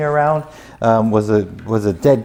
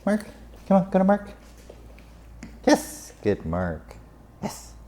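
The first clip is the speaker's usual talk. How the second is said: coaxing the dog; 8 semitones higher, 6 dB quieter than usual. Real, not acted.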